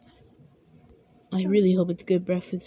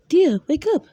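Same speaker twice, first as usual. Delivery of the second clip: higher, louder call to wake him; wife's voice; 7 semitones higher, 6 dB louder than usual. Acted. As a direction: nudge your sleeping husband awake. Wife waking husband.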